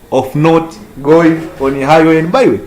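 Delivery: normal talking voice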